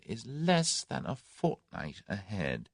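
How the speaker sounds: Tommy musing softly to his captors; thoughtfully. A narrator's reading. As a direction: weigh each word quietly. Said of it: "he said thoughtfully".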